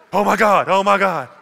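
loud, high hammed-up panicked cry; mock panic. Man at a wreck crying to God, mock panic. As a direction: play up the fright, shouting it to the heavens. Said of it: "came out of me was fear".

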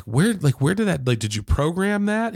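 a musician hearing unexpected trumpet; retold surprise. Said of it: "and I was like".

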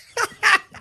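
sudden loud, high whoop of laughter. Real, not acted. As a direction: let a high laugh burst out. Laughs recalling the fight.

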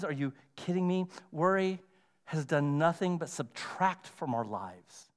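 ordinary talk

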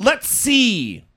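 slow, drawn-out mocking quote; mock Trump quote. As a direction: drag it out like a smug threat. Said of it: "is what he said".

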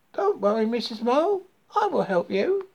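high-pitched frog offering help; high, helpful little frog; reassuring frog. A narrator's reading. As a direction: comfort the mole in a high frog voice.

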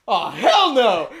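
high, drawn-out "hell no"; hammed-up alarmed brother; mock startled outburst. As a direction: react big, slowly. Brother spots the fight, rushes in.

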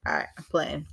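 unvoiced whisper, teasing; soft whispered joke; whispered aside. Real, not acted. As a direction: whisper it like a playful confession. Joke aside after sanitizer quip.

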